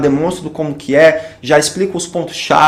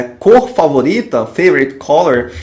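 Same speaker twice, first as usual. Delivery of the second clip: ordinary talk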